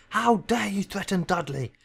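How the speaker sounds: high, loud, slow furious roar. Acted. Uncle defending his son, roaring. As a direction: roar it in drawn-out outrage. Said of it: "roared Uncle Vernon".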